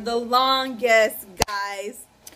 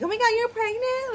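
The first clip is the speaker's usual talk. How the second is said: much higher, louder mock squeal; mom's excited squeal; 14 semitones higher, 4 dB louder than usual. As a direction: imitate mom gushing like a teen sister. Mom gushes like a sister.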